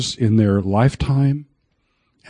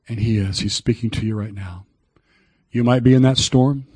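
ordinary talk, nothing marked

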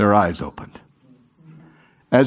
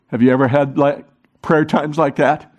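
usual speaking voice

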